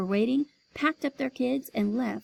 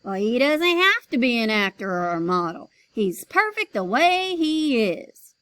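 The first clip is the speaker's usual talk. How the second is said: insisting; 7 dB louder than usual. Acted. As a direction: press the point firmly. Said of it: "Mister Vincent insisted".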